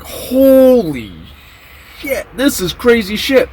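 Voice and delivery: high, long-stretched "holy"; retold stunned exclamation, drawn out; mock astonishment. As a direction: gawk aloud in a high voice. Teller acts own stunned reaction.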